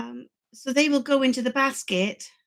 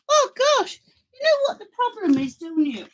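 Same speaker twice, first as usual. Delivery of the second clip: gushing; 10 semitones higher than usual. Real, not acted. Excited gush at viewers.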